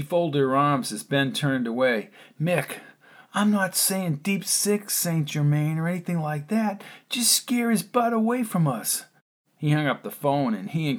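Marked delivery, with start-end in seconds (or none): 2.4-9.0 lightly voiced Ben. A narrator's reading. Ben urging Mickey on the phone, a touch of urgency. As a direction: press the friend on the phone to act now.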